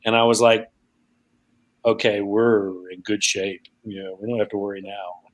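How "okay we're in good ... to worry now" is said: lower, softer retold reply; understated reassurance. Recalling the reply to Robert's hunter remark, quietly reassured.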